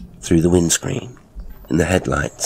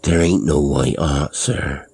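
plain reading voice

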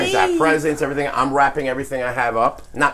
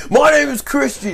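mock echo; 14 semitones higher, 5 dB louder than usual. Mocking friend's rant.

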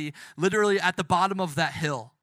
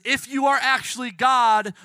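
raised, louder taunt; mocking; 5 semitones higher, 6 dB louder than usual. Thief taunting Jesus from the next cross, mocking. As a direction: jeer up at him, pitched high and loud. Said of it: "would be there to mock him".

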